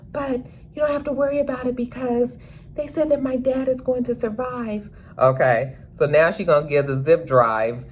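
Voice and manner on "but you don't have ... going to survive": put-on high, soft, timid girl's voice; a hesitant teenage girl, pitched up; Gemma's timid voice. Teen girl telling Trig about the video, high and timid.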